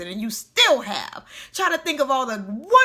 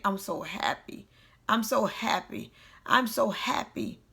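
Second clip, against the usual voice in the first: soft, subdued repetition; quietly convince yourself while hurting inside; 7 dB quieter than usual. Retold or imitated. Repeating a happy line to themselves while still down, hurting. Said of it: "I was hurting while I was saying it".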